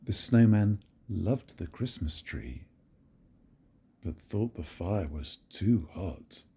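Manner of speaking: plain reading voice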